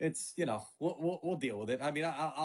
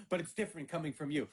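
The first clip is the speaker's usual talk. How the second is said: usual speaking voice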